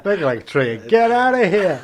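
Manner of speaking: loud laugh through the words; cracking up while saying it; laughing loudly. Real, not acted. Joking tree pun, laughing.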